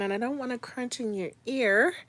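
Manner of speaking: usual speaking voice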